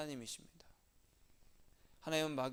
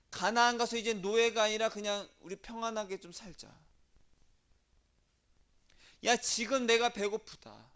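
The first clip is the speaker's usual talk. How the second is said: complaining Israelites; 4 semitones higher, 5 dB louder than usual. Retold or imitated. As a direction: voice the whining Israelites in the desert. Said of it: "가나안이었어요".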